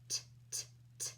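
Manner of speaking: voiceless "T, t, t" as the bare t sound. Shown as a demonstration. Teacher showing the kids the t sound, voiceless. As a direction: tap out "t" as a soft breathy t. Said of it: "sounding out the letter T".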